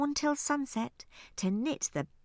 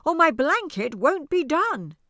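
raised, loud and high; crying out; 6 semitones higher, 6 dB louder than usual. Acted. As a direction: cry it out loud. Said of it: "cries Ernie".

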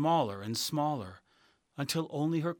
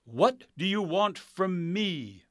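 high, slow, loud echoing voice; grand wizard voice; 7 semitones higher, 5 dB louder, slower than usual. Acted. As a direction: boom it down from above.

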